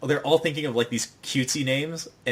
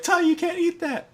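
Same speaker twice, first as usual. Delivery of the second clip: very high-pitched mock alarm; hammed-up, high-voiced protest. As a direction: squeal a warning as if stopping a toddler. Group stops Tai eating marker.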